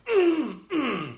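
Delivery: high yodel cry; yodeling sound demo. Shown as a demonstration. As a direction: break into a yodel. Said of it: "And then the yodel".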